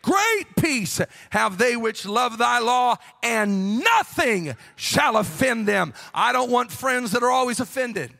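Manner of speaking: loud, high, chanted preaching; preaching at full force. Acted. Preacher exhorting the congregation, loud and chanted. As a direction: whoop it to the rafters.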